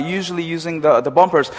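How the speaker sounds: ordinary delivery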